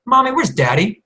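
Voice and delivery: put-on high, questioning child voice; lightly acted little-girl voice; imitated little girl. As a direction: lift it into a small girl's question. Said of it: "my little girl looks up at her mom and says".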